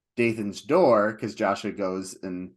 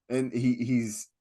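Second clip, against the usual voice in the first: ordinary talk, nothing marked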